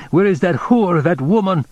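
exasperated despair, pitched high. A narrator's reading. Man waiting desperately for the hairdresser, despairing. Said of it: "he said in a despairing voice".

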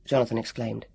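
usual speaking voice

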